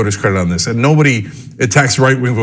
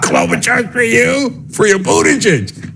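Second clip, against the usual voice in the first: incredulous mockery; 8 semitones higher, slower than usual. Real, not acted. Ridiculing Democratic picks.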